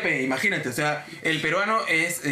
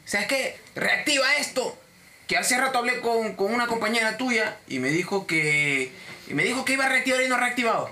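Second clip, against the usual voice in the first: overbearing customer, pitched up; arrogant; 5 semitones higher than usual. Retold or imitated. Cliente que da una orden al agente, prepotente. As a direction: order it done, higher and insistent. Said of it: "la voz, este, prepotente".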